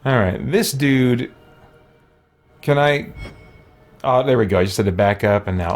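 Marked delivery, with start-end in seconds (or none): none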